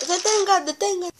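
high, alarmed cry to stop; panicked shout. Acted. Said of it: "gritó Thomas".

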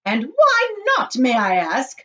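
high, loud, drawn-out challenge; indignant shout. A narrator's reading. Mother challenges chocolate maker, indignant shout. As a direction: shout back, demanding a reason. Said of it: "shouted Missus Gloop".

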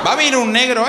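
normal talking voice

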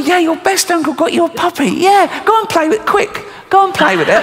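high, sing-song coaxing voice; sugary uncle. Retold or imitated. Uncle hurrying his niece off to play, high sing-song coaxing. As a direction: coax the child off to play, pitched high.

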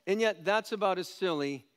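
ordinary talk

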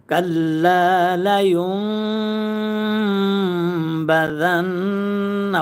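কাল্লা লায়ুনবাযান্না chanted slowly with the নবা and ন্না held in the nose; slow chant, long nasal hum on ন্না; nasal ghunnah. Shown as a demonstration. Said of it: "ওয়াজিব গুণনা".

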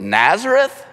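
high, scornful disbelief on "Nazareth?"; sneering. Retold or imitated. Nathanael sneering at Philip's claim about Jesus's hometown. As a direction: scoff at the very name "Nazareth?". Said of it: "Nathanael's kinda sneering a little bit".

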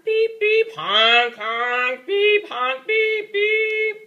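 loud, high beep and honk noises; mimicking a noisy jam of car horns. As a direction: blare like a street full of car horns. Imitating many car horns.

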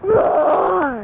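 loud tiger roar. Retold or imitated. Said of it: "How about a tiger?".

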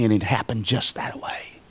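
soft unvoiced hush of awe; hushed whisper. Real, not acted. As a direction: drop to a whisper on the testimony. Testimony drops to a hush.

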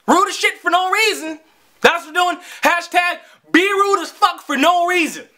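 high-pitched, exasperated rant; mock it like an absurd new trend; sarcastic indignation. Real, not acted. Sarcastic rant about the rude cashier.

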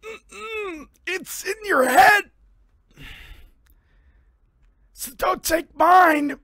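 put-on high, slow wobbly old man. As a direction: creak it out like a fussy old man. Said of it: "That's my old man voice".

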